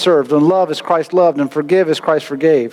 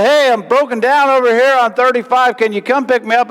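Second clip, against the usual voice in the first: stranded caller; 8 semitones higher than usual. Retold or imitated. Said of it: "if I tell you".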